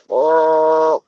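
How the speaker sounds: low rolled brr sound; imitating a low goose call. Showing her thing, low brr. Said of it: "but she goes".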